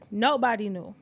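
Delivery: ordinary talk, nothing marked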